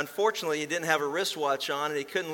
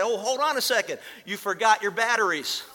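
high, louder call after someone walking off; calling after him; 6 semitones higher, 5 dB louder than usual. Retold or imitated. Inventor calls out to the departing buyer, raised and high. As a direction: shout after the buyer before he gets away.

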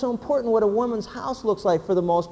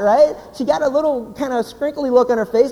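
usual speaking voice

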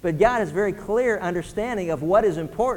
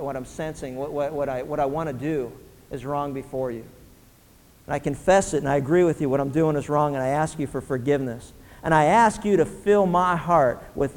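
prayer of confession; 4 semitones lower than usual. Shown as a demonstration. Pastor leads the congregation in a humble confessing prayer.

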